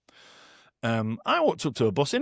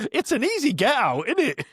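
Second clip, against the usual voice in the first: high teasing tone, much higher pitch; cheeky retort; 10 semitones higher than usual. Retold or imitated. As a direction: tease him with a bright high quip. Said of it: "I was like".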